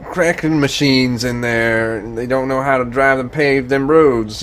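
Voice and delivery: slow mock Southern drawl with crude country slang. Mocking backroads Virginians, hammed-up slow Southern accent. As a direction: play a backwoods Southerner griping about the roads.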